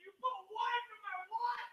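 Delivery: very quiet, high squeak of disbelief; stunned disbelief. Retold or imitated. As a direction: barely get it out, high and tiny. Stunned reply to being drugged.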